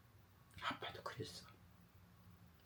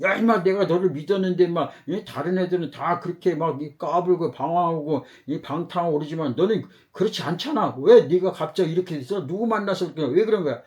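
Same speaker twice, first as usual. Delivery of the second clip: louder, higher scolding father; angry father; 3 semitones higher, 4 dB louder than usual. Retold or imitated. Father shouting at his wandering son, angry and louder. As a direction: yell at the kid like a disappointed dad. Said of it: "화내고 그러면은".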